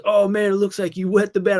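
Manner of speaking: loud, high kid voice with a hint of alarm; buddy's reaction. Buddy waking up, reacting to the wet sleeping bag. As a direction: voice the sleepy friend noticing the mess. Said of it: "He goes".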